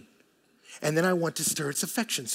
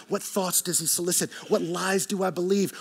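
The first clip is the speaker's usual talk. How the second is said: ordinary delivery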